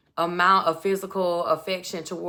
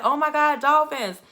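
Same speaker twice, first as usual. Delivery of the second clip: higher, thrilled mock exclamation; uber excited, imitated; 7 semitones higher, 4 dB louder than usual. Man spots dolphins on the date, imitated excitement. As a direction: imitate someone bursting with excitement. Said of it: "so he gets uber excited".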